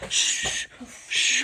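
soft "shh"; hushing shush. Pretend. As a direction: hush someone while hiding. Hushing partner while hiding.

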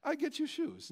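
a hint of a pushy Russian shop clerk; Russian woman. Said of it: "as Russian as could be".

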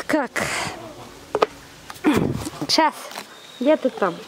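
normal talking voice

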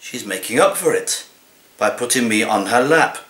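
ordinary talk

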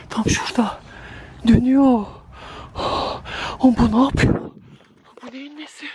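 high, tense panicky voice; frightened. Real, not acted. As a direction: speak in real fear. Watching something eerie, panicking. (korku içinde)

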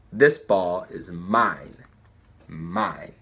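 low, very slow inner voice; a deep quiet voice from inside. A narrator's reading. Inner voice steadying the runner, low and slow. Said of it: "a voice said simply".

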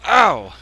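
loud sharp yelp of pain. Real, not acted. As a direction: yell out as if hurt.

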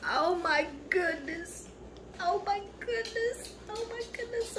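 hushed high gasping joy; overwhelmed delight. Real, not acted. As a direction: gush softly up high, overcome. Opening the gift, overwhelmed.

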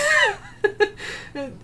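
a high burst of disbelieving laughter; exasperated laugh. Real, not acted. Vlogger laughing at a fake review, real laugh.

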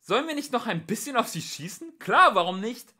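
high, loud cheerful suggestion; shouted back-and-forth between policemen; calling over. A narrator's reading. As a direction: shout it over to your partner. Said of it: "rief dem anderen zu".

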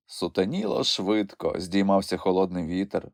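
ordinary delivery

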